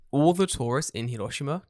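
normal talking voice

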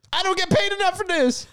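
squeaky whining complaint; high whiny voice. Retold or imitated. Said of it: "they actually just are like".

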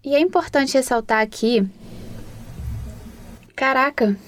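talking through hiccups; hiccuping between words. Real, not acted. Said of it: "tô com soluço".

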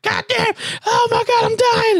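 extremely high squealed agony; hammed-up falsetto panic; mock screaming. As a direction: squeal as if being tortured by a massage. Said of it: "just making me scream".

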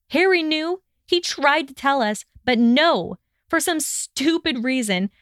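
high-pitched, exasperated reproach; bitter accusation. A narrator's reading. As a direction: reproach him, pitched well up. Bitter accusation in an acted quarrel.

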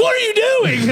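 very high, loud alarmed shriek; horrified shout at his mom; a teen shrieking from the shower at his mom; shocked shriek. Retold or imitated. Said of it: "I was like".

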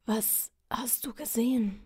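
faint whispered question; dying whisper. Acted. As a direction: whisper it, barely holding on. Dying king questions knight.

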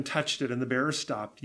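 usual speaking voice

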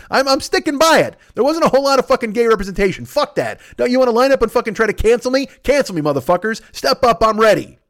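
defiant shouting. Acted. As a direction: bellow a dare at the haters. Defiant rant daring people to cancel.

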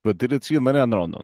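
mock Russian patter, run together; Russian mumbling. Shown as a demonstration. Cohost asks for his Russian, Russian mumbling. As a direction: mumble made-up words in a Russian accent. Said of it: "Just do Russian mumbling".

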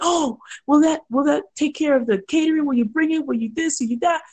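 high, rapid questions; imitating a fussing bride; anxious bride. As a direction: pile on the worried questions. Bride voiced, high pitch.

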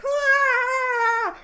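high, loud mock scream. Mocking Gamora's screaming in the fight, with a high, loud mock scream. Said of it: "Gamora's like".

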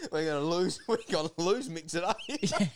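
high retold panic, breaking into laughter; laughing mock alarm. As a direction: laugh through a mock-worried cry. Retold panic told through laughter.

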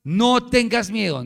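loud, slow, emphatic command, pitch lifted; firm reassurance. Acted. Elisha to his frightened servant, firm and fearless. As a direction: declare it boldly, drawing out each word. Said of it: "Eliseo no le dijo: "Ay, sí".

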